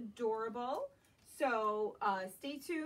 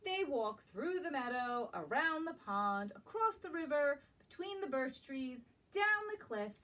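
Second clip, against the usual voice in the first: gentle, lilting storybook read-aloud; reading to children; 3 semitones higher than usual. Acted. Teacher reading a picture book to young children, lilting. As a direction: draw little listeners into the story.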